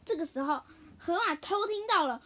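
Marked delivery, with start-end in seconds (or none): none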